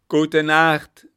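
very loud, high crowd shout; full-voiced communal yell; shouted farewell. A narrator's reading. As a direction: holler the goodnight as one crowd. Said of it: "riefen sie".

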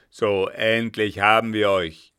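laut, triumphierend mit tiefem Atemzug; tief aufatmend. A narrator's reading. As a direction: endlich gefasst, aufatmend ausrufen. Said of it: "sagte der Kapitän tief aufatmend". (Kapitän ruft erleichtert, tief aufatmend)

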